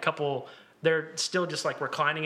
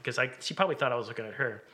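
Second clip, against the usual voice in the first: normal talking voice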